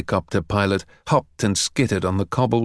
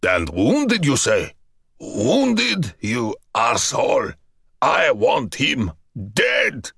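slow, snarling fury; raging general; slower than usual. A narrator's reading. Enraged general demanding the traitor's death. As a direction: spit each word with hate.

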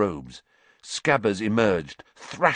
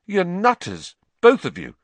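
shaky; 10 semitones higher, 5 dB louder than usual. A narrator's reading. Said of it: "said Ron shakily".

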